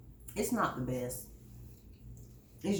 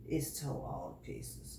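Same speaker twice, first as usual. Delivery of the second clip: whispered; 7 semitones lower, 10 dB quieter than usual. Real, not acted. Repeats the remark under breath.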